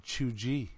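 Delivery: "chuggy" as choo-jee; deliberate mispronunciation. Shown as a demonstration. Host refusing to use the word properly, deliberate mispronunciation. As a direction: say "chuggy" with a soft j, quietly stubborn. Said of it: "I'm gonna pronounce it chuggy".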